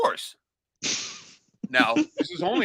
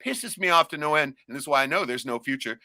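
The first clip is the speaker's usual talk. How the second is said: normal talking voice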